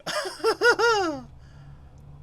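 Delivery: high, loud burst of real laughter; short high cackle of disbelief; incredulous laugh. Real, not acted. Laughing at the glitch.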